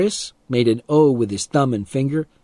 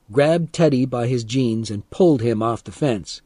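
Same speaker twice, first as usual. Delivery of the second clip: ordinary delivery, nothing marked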